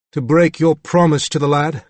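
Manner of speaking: a touch of crisp upper-class British; upper-class British accent. A narrator's reading. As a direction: speak through a still jaw, British accent. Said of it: "upper-class British accent".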